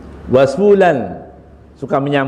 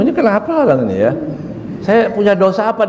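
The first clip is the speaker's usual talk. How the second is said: baffled person; 6 semitones higher than usual. Retold or imitated. Confused person's worried thoughts.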